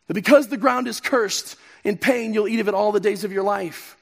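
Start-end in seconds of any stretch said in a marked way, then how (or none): none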